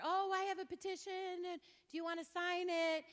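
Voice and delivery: high, bright-eager mock petitioner; imitating a chirpy young canvasser; mock eager young man. Speaker mimicking the young petitioner, high and eager.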